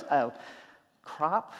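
usual speaking voice

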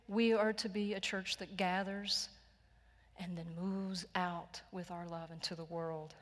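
usual speaking voice

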